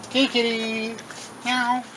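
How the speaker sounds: high sing-song kitty call; coaxing a shy cat out. Real, not acted. Calling the cat sweetly.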